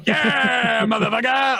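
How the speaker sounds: high, whooping celebration; excited cheer. Real, not acted. Celebrating a dice roll, excited cheer. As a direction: crow over a winning roll.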